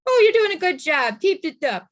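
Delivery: put-on high, gushing praise for a toddler; interrupting someone in a stall with sing-song praise; mock cooing praise. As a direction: coo it like a proud parent at the potty. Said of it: "it's like me coming into the stall and saying".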